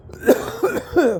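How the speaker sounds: hacking smoker's cough; ragged coughing fit; harsh cough. Real, not acted. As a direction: cough it out hard. Coughing after hit.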